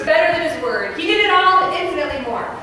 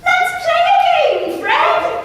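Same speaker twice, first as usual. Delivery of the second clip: high voice; 12 semitones higher, slower than usual. Acted. High child-like game call.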